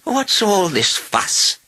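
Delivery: high, drawn-out indignant question; old lady crying out. A narrator's reading. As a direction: call out slowly as a put-out elderly lady. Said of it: "she cried".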